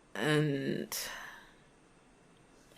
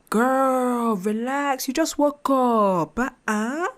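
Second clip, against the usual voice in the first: put-on much higher and louder, drawn out, girl relax; exasperated best friend snapping at her to chill; exasperated high scolding; 9 semitones higher, 7 dB louder, much slower than usual. To her anxious self in the mirror, loud high exasperated scolding. Telling herself off loudly.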